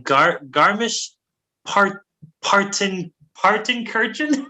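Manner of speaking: halting, high-pitched attempt at a foreign name; unsure pronunciation. Real, not acted. Host guessing at a German town name, tentative. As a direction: sound it out slowly, piece by piece, as a question. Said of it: "I think that's how you say it".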